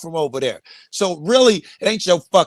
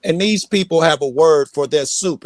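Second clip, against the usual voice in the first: usual speaking voice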